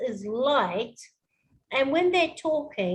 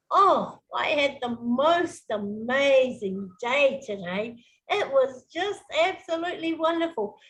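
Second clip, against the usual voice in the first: hammed-up amazement, voice jumping around; dramatic, emotional. Shown as a demonstration. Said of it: "Put some drama".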